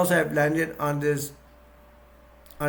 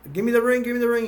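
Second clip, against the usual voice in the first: urgent demand; 8 semitones higher, 5 dB louder, much faster than usual. Retold or imitated. Yogurt urgently demands ring.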